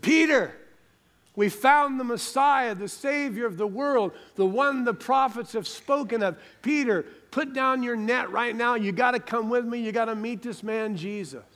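high, urgent shout to a brother; excited calling out. Acted. Andrew runs to tell his brother of the Messiah, excited calling out. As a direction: call out thrilled, pitched well up.

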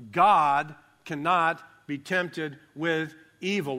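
slow, loud, GOD stressed; slow, loud emphatic reading. Shown as a demonstration. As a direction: lean hard on God, slowly. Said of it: "that's emphatic".

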